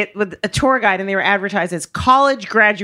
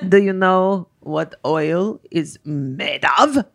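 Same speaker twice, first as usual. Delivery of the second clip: put-on slow, Dracula-like Greek accent; bad Greek accent; 4 semitones lower, much slower than usual. Greek driver quizzing his American passengers, ominous bad Greek accent. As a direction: draw the question out in a badly imitated Greek accent. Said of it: "I sound like Dracula".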